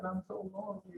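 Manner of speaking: high, soft, plaintive voice; lonely plea. Acted. Nanabuji explains to creation why he must leave, plaintive.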